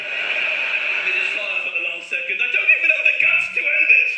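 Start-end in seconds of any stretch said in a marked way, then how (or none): 2.4-4.1 loud, high wail of a despairing pigeon; a suicidal pigeon wailing at the windscreen, loud and high; despairing shout. Pretend. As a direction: cry out like a pigeon with no nerve left.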